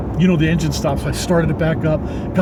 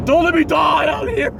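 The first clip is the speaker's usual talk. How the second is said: desperate prayer; 12 semitones higher than usual. Retold or imitated. Freezing under a tarp, begging God, mock-desperate high cry. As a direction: cry it up to the sky, half joking. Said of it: "Praying to God".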